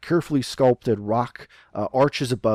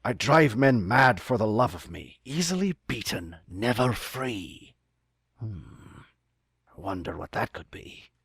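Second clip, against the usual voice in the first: musing pirate captain, trailing softer; captain puzzling over an inscription; pirate captain reading; 5 dB quieter than usual. Acted. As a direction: read out a riddle, slowing at the end. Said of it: "you hear the captain read something".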